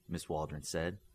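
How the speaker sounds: usual speaking voice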